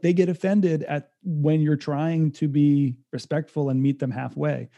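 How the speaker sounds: ordinary talk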